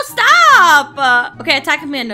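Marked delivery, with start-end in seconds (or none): 0.1-1.0 high, loud, drawn-out protest; exasperated yell. Real, not acted. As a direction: yell at the attacker to quit. Attacked by game enemy.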